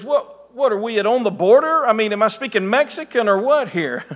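high, laughing disbelief; mock bafflement with a laugh; incredulous chef. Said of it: "he looks at me and he goes".

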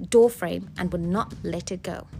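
ordinary delivery

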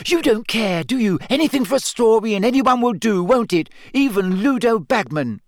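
high, indignant tirade; seething. A narrator's reading. Hermione accusing Rita of using anyone, seething. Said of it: "said through gritted teeth".

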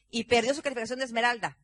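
normal talking voice

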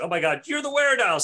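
high, loud panicked accusation; hammed-up accusing yell; panicked Hank. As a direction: shriek it at your brother in mock terror. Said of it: "says Hank".

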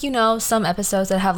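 normal talking voice

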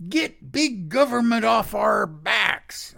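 loud, high slogan yell; mock patriot shout. Lecturer mimicking right-wing patriots' slogan, mocking shout. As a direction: holler it like a protest slogan, in mockery.

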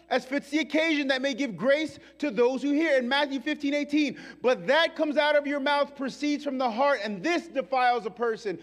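loud, high driving sermon; full-force preaching. Acted. Pastor to the congregation, loud fiery preaching. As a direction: preach it with fire.

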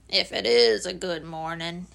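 low, dreary donkey voice; low, doleful doubt; gloomy Eeyore. Acted.

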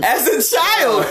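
high, slow, laughing through the words. Real, not acted. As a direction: chuckle it out as a fond memory. Laughing reminder of childhood hero.

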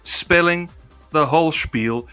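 ordinary talk

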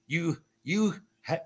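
usual speaking voice

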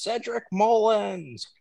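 high, drawn-out mock yell; imitated yell. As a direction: stretch out the name in a high-pitched imitation of a yell. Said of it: "So he always yells".

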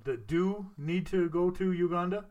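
normal talking voice

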